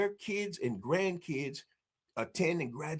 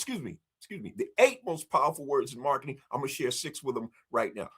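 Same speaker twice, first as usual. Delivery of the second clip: ordinary talk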